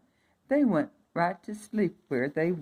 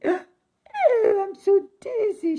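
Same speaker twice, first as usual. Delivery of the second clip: high, faint and swooning; sway as if about to faint; dizzy princess; 5 dB louder, slower than usual. A narrator's reading. Princess pricked by the spindle, high and swooning. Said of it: "she lay down".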